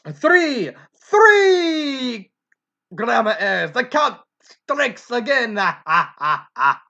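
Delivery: put-on Transylvanian-accented vampire count, shouting the number. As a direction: boom out the count like a gleeful vampire, then cackle. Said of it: "I like doing the count voice".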